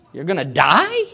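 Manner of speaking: "die" rising in disbelief; incredulous question. Acted. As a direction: repeat it back, not believing it. Disciple repeats Jesus incredulously.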